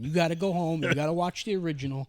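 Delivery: mock-stern urging, pushed up in pitch; insistent retold order. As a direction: lay down the law as the obsessed fan. Retelling boss's insistent orders.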